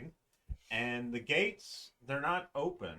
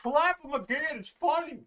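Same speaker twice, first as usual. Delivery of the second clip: much higher, gleeful little voice with a laugh; squeaky trapped head; 6 dB louder than usual. Acted. Trapped head urging the guard to slap again, high and giggly. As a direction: egg the guard on in a tiny high voice. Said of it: "you see the face above the ass crack go".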